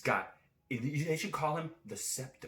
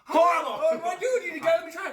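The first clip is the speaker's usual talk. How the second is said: mock outrage; 10 dB louder than usual.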